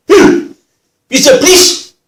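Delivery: loud, hostile demand; gruff, angry. Retold or imitated. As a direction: burst in shouting, drunk and hostile. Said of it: "very gruff".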